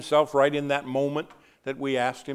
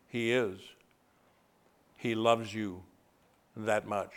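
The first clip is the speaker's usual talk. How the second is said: tender; 4 semitones lower, 6 dB quieter than usual. Real, not acted. Preacher softly comforting the bereaved. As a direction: hush down and comfort the grieving.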